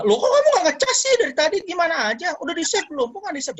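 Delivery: high-pitched nagging parent imitation; mock reactive scolding. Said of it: "jangan langsung malah". (menirukan orang tua mengomel)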